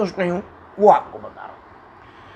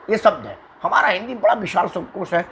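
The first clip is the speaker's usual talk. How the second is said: ordinary talk, nothing marked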